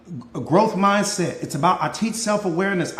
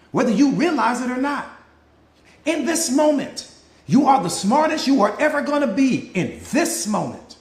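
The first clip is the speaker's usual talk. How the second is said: preaching; 5 semitones higher than usual. Real, not acted. Coach preaching to live audience.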